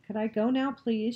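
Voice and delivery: impatient. A narrator's reading. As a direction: ask with a touch of impatience. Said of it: "she asked impatiently".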